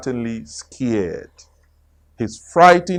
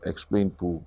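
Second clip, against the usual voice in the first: usual speaking voice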